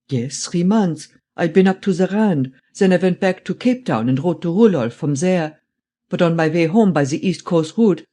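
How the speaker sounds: put-on old man's German-accented voice; old German traveller. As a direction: recount it with a strong German accent.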